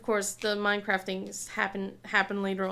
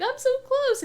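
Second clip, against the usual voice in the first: pleading excited; 4 dB louder than usual. Retold or imitated. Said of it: "I was like".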